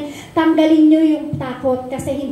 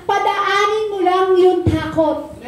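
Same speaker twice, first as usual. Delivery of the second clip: padaanin mo lang yung takot drawn out slowly, each word weighed; slow emphasis; 3 semitones higher, slower than usual. Shown as a demonstration. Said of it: "sabi nga po kanina".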